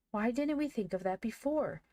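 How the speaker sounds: high, animated exclamation; crying out. A narrator's reading. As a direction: exclaim at the sudden realization. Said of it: "cried Van".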